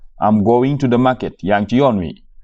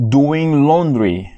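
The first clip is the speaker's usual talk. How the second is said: slow model phrase; 4 semitones higher, much slower than usual. Shown as a demonstration. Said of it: "teacher models the phrase".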